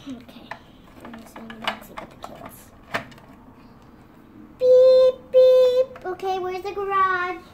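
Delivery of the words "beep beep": blaring horn, high and loud; loud car horn beep. Pretend. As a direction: honk it out high.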